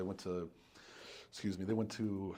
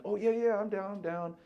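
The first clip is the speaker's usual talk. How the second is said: eager customer; 9 semitones higher than usual. Retold or imitated. Voicing eager customer on phone.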